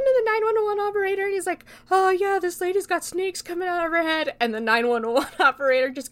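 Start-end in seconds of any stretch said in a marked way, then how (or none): 1.9-4.3 put-on high, loud, excited kid voice; frantic caller. Teen reporting Medusa to 911, frantic high voice. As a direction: blurt it out like a panicking teen on the phone.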